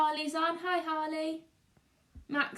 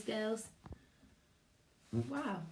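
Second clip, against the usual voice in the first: plain reading voice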